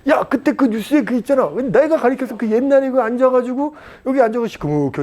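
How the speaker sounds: high, animated pretend boasting; acted bragging. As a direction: excitedly show off someone you once taught. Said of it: "그 애들한테 얘기할 거야".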